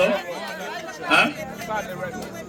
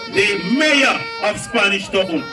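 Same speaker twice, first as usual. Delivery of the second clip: rally announcer intro; 7 semitones higher than usual. Real, not acted. Introducing the next MP to the rally crowd, booming announcer hype. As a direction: build the crowd up and present the next MP.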